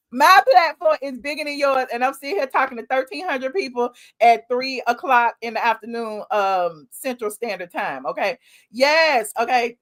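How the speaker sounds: ordinary delivery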